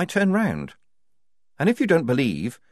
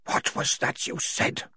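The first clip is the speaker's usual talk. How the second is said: menacing whisper; 5 dB quieter, slower than usual. A narrator's reading. Tonka going pale, menacing.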